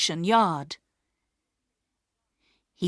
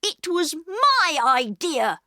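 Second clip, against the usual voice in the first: put-on much higher, slower, stubborn boy; Peter insisting; 10 semitones higher, 4 dB louder, slower than usual. A narrator's reading. Peter protecting his sisters, higher and stubborn. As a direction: take the blame firmly. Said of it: "said Peter angrily".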